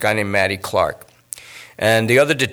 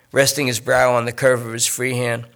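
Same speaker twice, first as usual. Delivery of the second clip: plain reading voice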